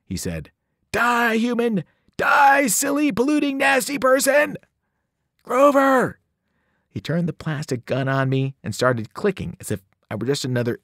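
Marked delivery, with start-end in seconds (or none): 0.9-4.6 high, loud battle cry at the screen; game-crazed shout. A narrator's reading. Satyr shouting at virtual enemies, high and loud. Grover lost in video game. 5.4-6.2 high, loud call; second louder shout. A narrator's reading. Friend yelling at entranced satyr, high and loud. Calling him again.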